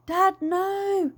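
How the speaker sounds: high frightened shout. A narrator's reading. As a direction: cry out to stop him. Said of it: "shouted Lizzie".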